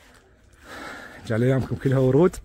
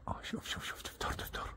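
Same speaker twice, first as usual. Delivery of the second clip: whisper; 20 dB quieter than usual. Real, not acted. Hushed watching of birds.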